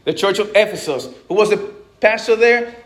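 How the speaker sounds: ordinary talk, nothing marked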